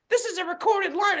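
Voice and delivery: very high, mimicked retort; quarrelling tenant. Tenant threatens landlord on phone, high imitated retort.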